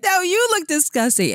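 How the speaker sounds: high-pitched imitated retort; mock insulting comeback. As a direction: playfully throw the insult back, pitched high.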